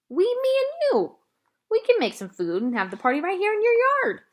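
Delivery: high, loud and eager; little girl thrilled by her plan; excited child. A narrator's reading. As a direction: pitch it way up with excitement. Opal eagerly explains party.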